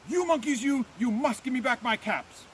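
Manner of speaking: high-pitched, contained storybook yell; very angry shout. A narrator's reading. Peddler stamping both feet at monkeys, angry shout. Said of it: "both his feet and shouted".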